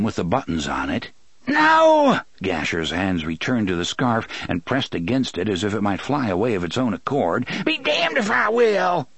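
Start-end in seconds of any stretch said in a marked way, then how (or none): 1.5-2.2 high, loud cry; panicked high shout; shouted refusal. A narrator's reading. 7.6-9.1 high, strained refusal; high-pitched defiant yell; defiant outburst. A narrator's reading.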